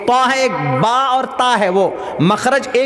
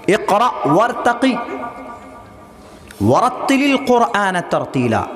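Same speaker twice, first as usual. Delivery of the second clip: clear measured recitation; slower than usual. Shown as a demonstration. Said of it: "صاف صاف".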